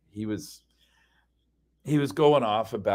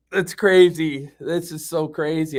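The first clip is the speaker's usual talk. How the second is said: loud, higher, drawn-out exclamation; raised-voice incredulity; exasperated disbelief; 6 semitones higher, 5 dB louder, slower than usual. Retold or imitated. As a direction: stretch it out in disbelief. Retelling his reaction to argument.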